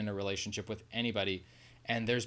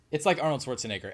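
usual speaking voice

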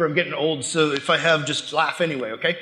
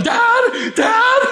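high-pitched retold yell; 4 dB louder than usual. Son searching the house for his dad, yelling. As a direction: call out for a missing parent, pitch way up. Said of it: "like, yelling".

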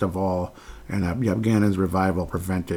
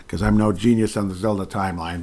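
ordinary delivery

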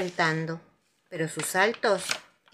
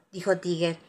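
ordinary delivery, nothing marked

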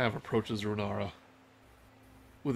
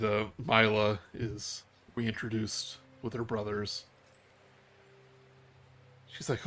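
normal talking voice

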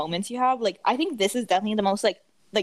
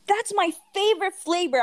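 whiny customer; 10 semitones higher than usual. Retold or imitated. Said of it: "They're like".

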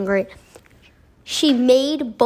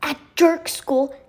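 angry shout; 4 semitones higher than usual. A narrator's reading. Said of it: "started shouting".

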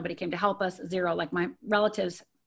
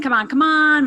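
dad's loud, higher-pitched hurry-up; impatient call; 6 semitones higher, 8 dB louder than usual. Retold or imitated. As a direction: call out to hurry the family along. Dad hurrying family out, voiced.

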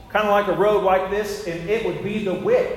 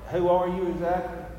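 mock Moses; 3 dB quieter than usual. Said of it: "I don't know that he said it like that".

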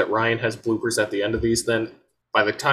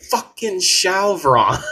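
high, drawn-out mock exasperation; raccoons' grumbling thought; 8 semitones higher, much slower than usual. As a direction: voice the raccoons' thought, slow and raised. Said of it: "are still just thinking".

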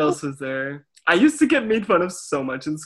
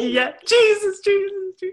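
high mock praise. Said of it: "You're like".

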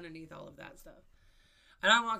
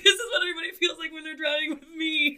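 laugh-cry at the irony; laughing disbelief; 10 semitones higher, 13 dB louder than usual. Real, not acted. Realizing how others feel in her car, laugh-crying.

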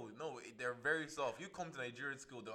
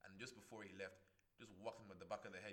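ordinary talk, nothing marked

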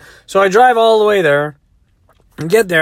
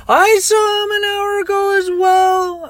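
hysterical crying; slower than usual. Retold or imitated. Crying woman answers her doubting driver, hammed-up wail. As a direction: stretch it into an exaggerated bawl. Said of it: "She's crying".

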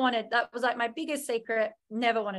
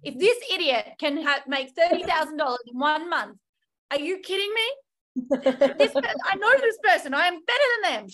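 higher, louder indignant rant at idiots; annoyed rant; 5 semitones higher, 4 dB louder than usual. Retold or imitated. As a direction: scoff at them, loud and high. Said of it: "I was so annoyed".

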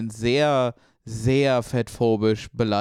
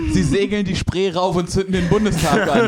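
laughing; 5 semitones higher, 4 dB louder than usual. Real, not acted. Laughing joke.

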